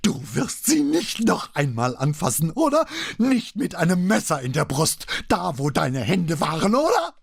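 hysterical high-pitched threat. A narrator's reading. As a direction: threaten him, screeching on each oder. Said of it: "Ihre Stimme kletterte um noch eine Oktave höher". (schrill keifend vor Wut)

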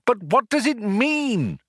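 high, mildly exclaimed question; crying out. A narrator's reading. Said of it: "cried Arthur".

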